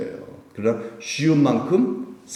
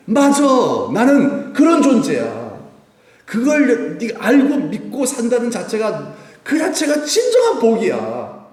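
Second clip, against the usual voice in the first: high, loud, slow joyful praise with a laugh; Jesus beaming at Peter; overjoyed Jesus; 12 semitones higher, 6 dB louder, slower than usual. Acted. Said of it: "예수님 너무너무 기뻐하세요".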